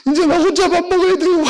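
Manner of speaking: high, blubbering mock-tearful voice; mock crying. Husband to wife over dinner, mock crying. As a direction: wail it like a hammed-up sob. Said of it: "밥 먹는데 울어요".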